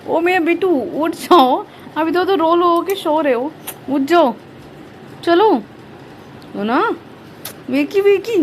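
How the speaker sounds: sing-song wake-up call. Real, not acted. Mother rousing her curled-up child, high sing-song coaxing.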